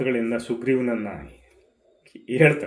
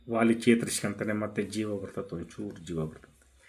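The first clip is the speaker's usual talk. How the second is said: ordinary delivery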